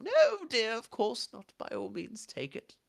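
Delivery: put-on high-pitched old lady, obliging; elderly woman. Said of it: "an elderly woman sat by the fire".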